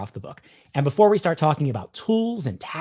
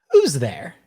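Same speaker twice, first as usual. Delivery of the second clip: louder, slightly different voice for the other side; joke listener answering; 6 dB louder than usual. Pretend. Playing the listener of his own joke, slightly louder. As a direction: answer the knock as the other person. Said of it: "I'll just do both sides of the joke".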